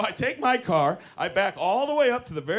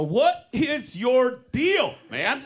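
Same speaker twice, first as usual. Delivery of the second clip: slow, drawn-out exasperated question; exasperated demand; 5 semitones higher, 4 dB louder, much slower than usual. Retold or imitated. Confronting the driver over the parking spot, slowly exasperated. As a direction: stretch each word at him.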